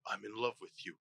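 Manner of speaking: put-on soft, low Russian accent; quiet, deep Russian-accented confession. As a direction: murmur it low as a defeated Russian. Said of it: "Russian accent".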